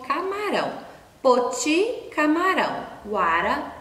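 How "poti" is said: ordinary delivery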